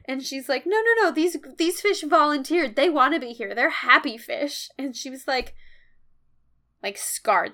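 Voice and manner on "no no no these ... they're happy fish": quick-to-soothe teacher, raised voice; trying to calm students. Retold or imitated. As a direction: act out a teacher insisting all is fine. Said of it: "trying to keep all the students, like, calm".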